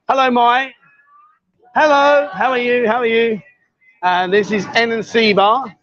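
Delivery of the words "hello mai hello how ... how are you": cheery greeting. Real, not acted. Greeting a bar girl warmly.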